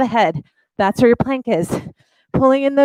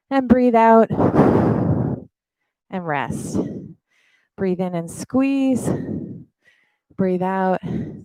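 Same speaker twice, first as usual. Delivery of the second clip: guided breathing; 3 semitones lower, slower than usual. Acted. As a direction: guide the breaths gently and slowly. Leads a breathing cool-down.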